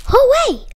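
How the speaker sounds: high, loud hooray; happy cheer. Acted. Friends cheering Miss Fuzzy Bottom's welcome home. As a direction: cheer as the party begins.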